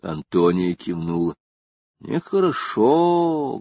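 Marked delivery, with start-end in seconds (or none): 2.0-3.6 drawn out, high, ponderous pronouncement; profoundly thoughtful, very slow and high-pitched; deep in thought. A narrator's reading. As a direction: stretch it out high, as a weighty verdict. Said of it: "глубокомысленно заметила она".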